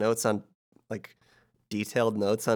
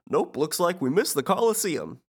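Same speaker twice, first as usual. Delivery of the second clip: Goofy; 7 semitones higher than usual. Retold or imitated. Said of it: "Goofy says".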